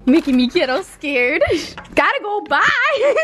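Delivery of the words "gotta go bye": giggling goodbye; laughing. Real, not acted. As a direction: squeal it through laughter. Runs off laughing.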